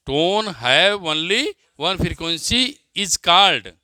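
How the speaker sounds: slow, deliberate dictation pace. Acted. Teacher dictating a definition to students, slow dictation. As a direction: spell it out word by word for note-taking.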